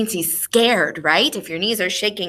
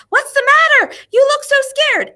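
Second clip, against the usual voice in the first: much higher, louder mock concern; friends asking; 7 dB louder than usual. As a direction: ham up the worried cowboy friends. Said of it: "all his tough cowboy friends said".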